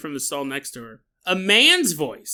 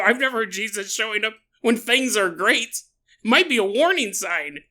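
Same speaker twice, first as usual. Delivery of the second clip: normal talking voice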